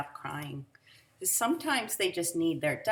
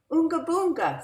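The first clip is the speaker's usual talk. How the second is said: put-on high, loud silly baby talk; big brother voice; 12 semitones higher, 10 dB louder than usual. A narrator's reading. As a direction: coo nonsense at the baby. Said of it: "said Daniel again".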